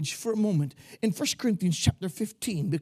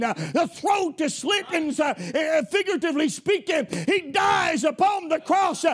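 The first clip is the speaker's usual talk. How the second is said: higher, louder, rousing preacher's cry; full-force preaching; 7 semitones higher, 4 dB louder than usual. Acted. Preacher rousing his church about their Joshua, higher and louder. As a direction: preach it out, lifting the whole congregation.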